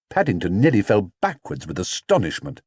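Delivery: ordinary delivery, nothing marked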